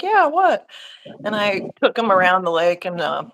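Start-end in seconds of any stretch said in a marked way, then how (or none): 0.0-0.7 high, sudden "Yeah, what?" from a puzzled husband; surprised husband. Retold or imitated. Husband asked by his wife, surprised. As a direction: toss it back startled. Husband answering in retold story.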